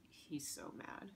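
usual speaking voice

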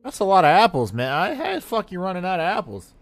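loud, high burst of disbelief; loud high outburst; exclamation. Real, not acted. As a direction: blurt it out, worked up. Excited outburst about apples.

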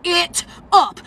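usual speaking voice

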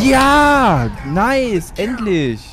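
high, hopeful cheering; excited cheer. Real, not acted. Cheering while waiting.

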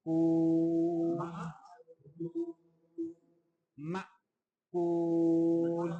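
slow, drawn-out melodic tajweed recitation; Quran recitation. Shown as a demonstration. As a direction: chant each verse slowly, stretching the vowels. Quran recitation at class start.

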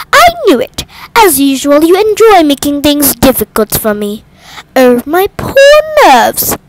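high, loud, dramatic complaint; a fretful mother wailing about her nerves; indignant wail. A narrator's reading. Mrs Bennet frets at husband.

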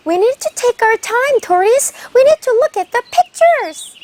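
a hare gently urging patience. Acted. 토끼가 거북이에게 천천히 하자고 말하는 높은 목소리. Hare voiced telling tortoise.

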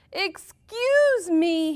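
high, loud, slow shout; drawn-out shout. A narrator's reading. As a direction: yell it out over the noise. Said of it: "he shouted".

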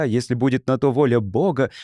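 ordinary delivery, nothing marked

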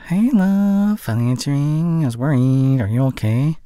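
loud, high-pitched worried greeting; hammed-up anxious sweetheart; concerned lover. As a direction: greet your love in a high, exaggerated voice. Outtake of greeting on phone.

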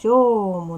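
"сьому" with a loud, held "сьо"; stress on first syllable. Shown as a demonstration. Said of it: "наголошений перший".

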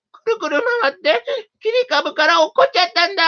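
high little mouse voice, cheerful; chatty mouse. A narrator's reading. Mouse tells his story.

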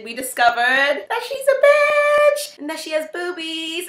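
high, slow, loud disbelief; mock shocked. Real, not acted. Comic reveal of dog's sex.

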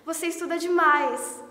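loud, exasperated mimicry of siblings; nagging siblings. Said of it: "eu me importo quando eles começam".

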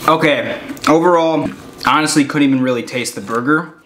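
chewing between words; talking around food; mouth partly full. Real, not acted. Seen 2: Holds bitten burger; lips pursed, chewing-like motion between words after bite.

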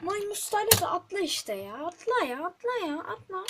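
pleading at the screen to jump; frantic urging. Real, not acted. Frustrated urging character to jump.

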